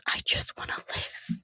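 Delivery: tiny, helpless whisper; barely audible, all breath; whispered plea. Acted. Cowed character pleads to others.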